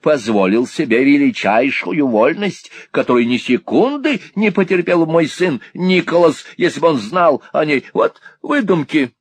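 high, indignant lady's protest; heated retort. A narrator's reading. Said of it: "с жаром возразила миссис Никльби".

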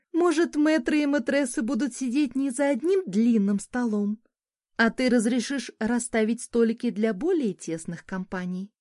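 high, lilting society lady; sultry woman. A narrator's reading. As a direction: coax him like a charming hostess. Narrator voices Dessa.

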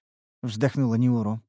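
ordinary delivery

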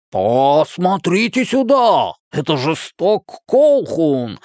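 drawn-out, high-pitched hollering caricature; slow singsong cartoon holler; put-on Jim voice. A narrator's reading. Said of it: "прибег к голосу нигера Джима".

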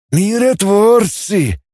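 high, drawn-out scornful word; slow, high-pitched disdain; muttered disdain. A narrator's reading. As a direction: draw it out with contempt. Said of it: "процедил Гум".

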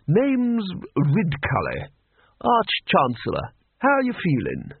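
put-on high, booming old gentleman; jolly old don greeting; hearty Arch-Chancellor. A narrator's reading. Ridcully introduces himself.

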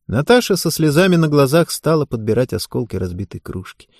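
ordinary delivery, nothing marked

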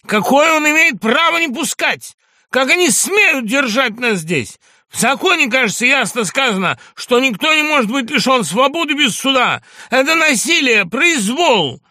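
patient raging at the guards; indignant shouting. Acted. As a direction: rail against injustice. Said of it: "вскрикнул Иван Дмитриевич".

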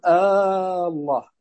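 الله opened with a long held "aaa"; lengthened opening hamza. Shown as a demonstration. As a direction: stretch the first vowel of الله slowly, like a question form. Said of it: "مثل مد همزة". (مد همزة "الله" في أولها)

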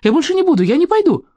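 put-on very high, pleading boy's protest; frightened cry. A narrator's reading. Кириллка clings to his father, frightened cry. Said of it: "закричал он, хватаясь руками за отца".

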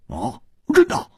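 mild surprised question; puzzled. A narrator's reading. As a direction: ask with naive wonder. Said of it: "他奇怪地说". (奇怪地问)

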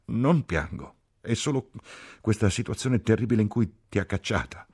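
high, tearful protest; sobbing. A narrator's reading. Ferguson insists she is not crying, tearful and high. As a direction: protest in a broken, weepy voice. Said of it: "singhiozzò la Ferguson".

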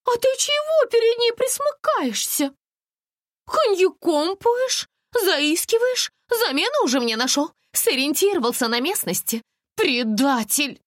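high, loud jealous outburst; shrieky betrayed lover; jealous accusation. A narrator's reading. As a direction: accuse him in a high, indignant voice. Ревнивая ссора, обвиняет в предательстве.

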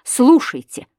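loud, high command; insistent call. A narrator's reading. Husband turns to his wife, insistent call. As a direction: demand her attention sharply. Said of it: "продолжал он, обращаясь к".

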